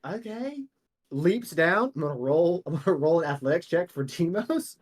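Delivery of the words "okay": high, soft, hesitant agreement; small high-pitched voice; Bill giving in. Acted. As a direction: agree timidly, lifting at the end. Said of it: "Bill goes".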